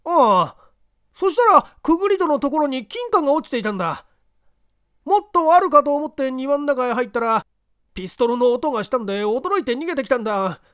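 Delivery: loud high anxious telling; nervous street tough. Acted. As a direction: rush through the story. Kinkou tells story.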